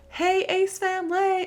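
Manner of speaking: high sing-song vlogger hello, a bit slow; playful repeat of the intro; vlog intro greeting. Retold or imitated. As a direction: relish saying the catchphrase. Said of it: "so addictive to say".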